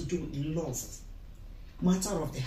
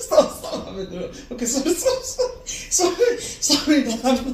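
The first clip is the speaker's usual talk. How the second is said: louder and higher, laughing through every word; laughing apology; 7 semitones higher, 9 dB louder than usual. Real, not acted. Friend laughs while apologising to her crying friend, loud and high. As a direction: say sorry, but keep cracking up. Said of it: "sorry that I'm laughing while you're crying".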